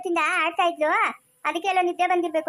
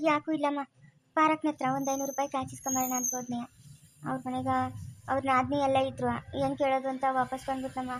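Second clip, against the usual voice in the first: subdued, soft and downcast; quietly dejected; 5 dB quieter than usual. Acted. Telling mother about coming back without borrowing money, subdued. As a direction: confide it softly, disheartened.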